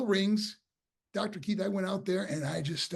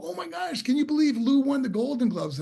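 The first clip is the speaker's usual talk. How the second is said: high-pitched mock amazement, building louder; amazed gossip; 9 semitones higher, 5 dB louder than usual. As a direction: gasp it out like stunned schoolmates. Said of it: "And people go".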